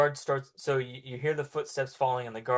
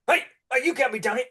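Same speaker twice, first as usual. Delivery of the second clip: guard shouts; 10 semitones higher, 6 dB louder, faster than usual. Acted.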